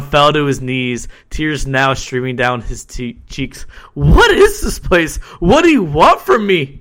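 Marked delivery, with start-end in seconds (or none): 3.9-6.8 frightened plea. A narrator's reading. Terrified captive begs dark figure.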